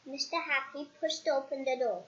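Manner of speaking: usual speaking voice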